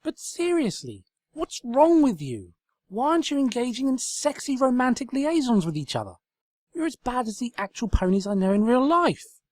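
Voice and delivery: loud, high exasperated rant; high-pitched frustrated tirade. Acted. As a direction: rail at the furniture. Twilight ranting at furniture.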